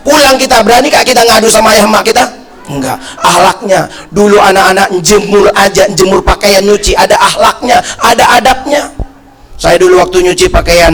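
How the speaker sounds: ordinary talk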